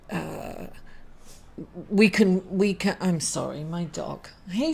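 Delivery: ordinary delivery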